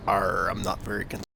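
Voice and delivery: weak pirate growl, barely there. Acted. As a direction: try a pirate accent and fail. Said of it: "You don't sound like a pirate".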